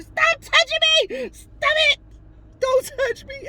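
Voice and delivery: high, loud, distraught shouting; screaming at strangers in the bathroom; frantic yelling. Retold or imitated. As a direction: yell at them to back off. Strangers touch her while sobbing.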